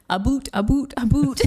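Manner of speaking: "boot" for about, rising, in a mocking Canadian accent; a hammed-up Canadian "a boot" that drifts toward Indian. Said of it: "You're not really sounding Canadian there".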